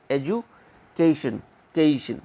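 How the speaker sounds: एजुकेशन slowly split into "edju" and "kay-shun"; syllable breakdown. Shown as a demonstration. Teacher splits the word for students, syllable breakdown. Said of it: "इसका pronunciation लिख के दिखाता".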